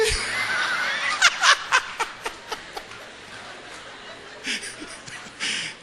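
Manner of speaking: high breathless laugh at his own story; real laughter. Real, not acted. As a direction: burst out laughing.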